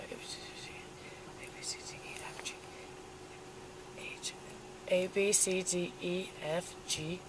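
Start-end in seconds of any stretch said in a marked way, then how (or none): 0.1-4.3 letters fading to a whisper. Real, not acted. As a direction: trail off into a whisper. Drunk test, trailing off.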